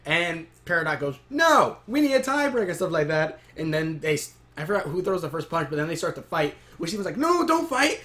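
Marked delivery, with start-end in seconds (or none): 1.3-2.7 high-pitched mock protest; indignant objection. Peridot refusing a tie with Pearl, indignant objection. 7.2-8.0 high, stretched mock plea; mock pleading. Steven as the two start to fight, mock pleading.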